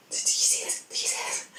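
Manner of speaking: breathless whisper to a friend; excited whisper. Retold or imitated. To her friend in the cinema, an excited whisper. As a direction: hiss it under your breath, thrilled.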